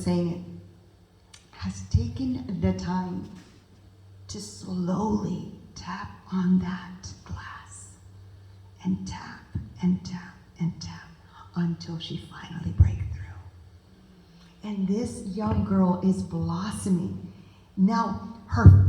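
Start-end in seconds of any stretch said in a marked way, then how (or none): 4.3-14.7 slow, soft whispered telling; slow hushed whisper full of tenderness. Acted. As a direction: whisper it gently, like tapping on glass. Teacher patiently tapping on glass.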